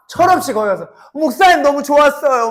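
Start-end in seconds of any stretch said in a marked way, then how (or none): 1.1-2.5 bragging to pastor. Pretend. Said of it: "막 이러면서 목사님한테 자랑하면".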